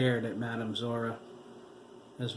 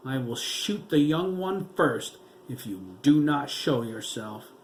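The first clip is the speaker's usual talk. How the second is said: threatening; 3 semitones higher, 6 dB louder than usual. A narrator's reading. Officer threatening to shoot the girl, loud. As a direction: bark the ultimatum at the crowd.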